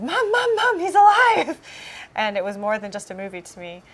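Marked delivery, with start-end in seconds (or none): 0.0-1.6 high, loud, laughing cry of joy; a little kid yelling the news with delight; excited child shout. Retold or imitated. Child running to mom, shouting that he's alive with joy. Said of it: "and I said".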